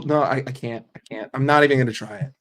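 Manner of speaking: usual speaking voice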